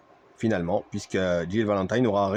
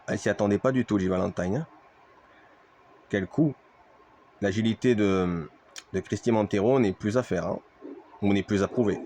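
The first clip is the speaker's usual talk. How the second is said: wrestling commentator; 3 semitones higher than usual. Acted.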